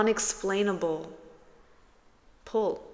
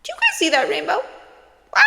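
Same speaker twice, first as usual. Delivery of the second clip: high, loud, fast rainbow excitement; high fast excited voice; excited squeal; 10 semitones higher, 8 dB louder, much faster than usual. Real, not acted. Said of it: "Why am I talking like that?".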